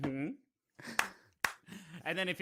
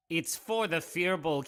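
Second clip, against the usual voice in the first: normal talking voice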